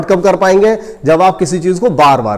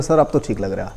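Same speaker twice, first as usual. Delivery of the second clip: quiet, lowered voice of a hesitant reply; timid student; 9 semitones lower, 8 dB quieter than usual. Retold or imitated. Student timidly answering the maths teacher on the phone, meekly. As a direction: imitate a timid student, low and soft. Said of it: "किसी ने डर के मारे कह दिया".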